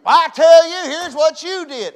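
high loud slowed mock rant; a husband shouting out his frustration; angry husband. Husband snapping back at a loving wife, loud and high.

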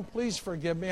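ordinary talk

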